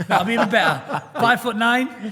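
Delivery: laughing it out, high and loud; cracking up while teasing about height; giggly loud tease. Real, not acted. Joking about a colleague's height.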